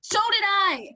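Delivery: sudden high, loud retort; defiant outburst. Acted. Wife confronting her ex, loud and defiant. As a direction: throw the confession right back at him.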